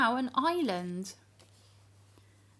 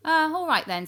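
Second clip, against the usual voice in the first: higher, louder grandpa voice; kindly grandfather agreeing; old Grandpa; 8 semitones higher, 6 dB louder than usual. Acted. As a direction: give in like a cheerful old man.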